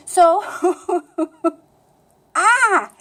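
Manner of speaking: "so" into laughter, then loud high "ah"; laugh, then let out a high exclamation; laughing outburst. Real, not acted. Speaker embarrassed by her own pronunciation, laughing outburst.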